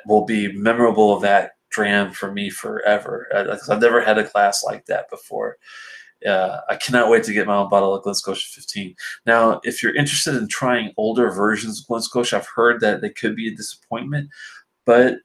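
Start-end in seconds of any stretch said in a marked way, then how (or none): none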